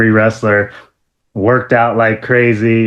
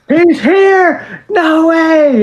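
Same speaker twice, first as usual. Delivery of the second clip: much higher, thrilled; disbelieving excitement; 3 dB louder, much slower than usual. Retold or imitated. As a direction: squeal it like a fan. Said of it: "I'm like".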